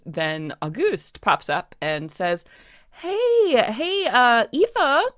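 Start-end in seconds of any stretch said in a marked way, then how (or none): 2.9-5.1 high, eager voice, dragging the end; stage manager tells Aoife her parents came, high and eager; excited stage manager. Acted. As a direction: bubble over with a surprise.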